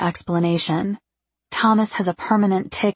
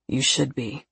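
low, soft male reproach; stern Thomas; 5 semitones lower, 5 dB quieter than usual. A narrator's reading. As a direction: drop low and quiet to reprove.

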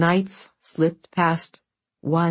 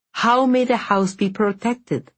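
higher, pressing question; urgent; 6 semitones higher than usual. A narrator's reading. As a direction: ask it with urgency. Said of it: "said Vasya urgently".

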